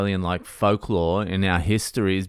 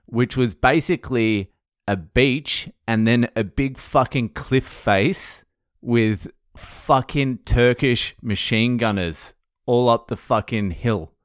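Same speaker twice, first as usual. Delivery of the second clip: ordinary talk, nothing marked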